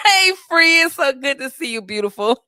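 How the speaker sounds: high, loud, delighted hello; excited greeting. Real, not acted. Greeting a viewer who just joined, excited and loud. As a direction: greet them with a burst of laughing excitement.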